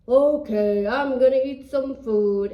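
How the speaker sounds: loud giant voice. Acted. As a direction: boom it out like a hungry giant. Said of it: "comes back and says".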